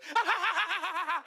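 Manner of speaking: a forced laugh that tries too hard; fake forced laugh. Imitating Tom Cruise's awkward laugh at a joke. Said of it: "Tom laughs like this".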